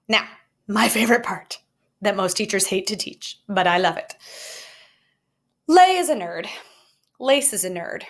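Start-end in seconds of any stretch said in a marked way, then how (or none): 5.7-6.5 playful announcement. Acted. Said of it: "Look at them".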